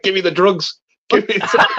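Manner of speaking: high, laughing junkie plea; imitating the robot's drug-hungry claw, high and laughing; craving robot voice. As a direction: beg for the drugs, giggling. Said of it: "and it's like".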